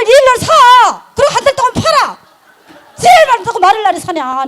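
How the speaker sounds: shrill-free but high, loud and fast in places, scolding a friend; high-pitched scolding rant. Retold or imitated. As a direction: tell him off at the top of your voice. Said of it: "내가 막 그랬어요". (친구를 높은 목소리로 크게 나무라듯)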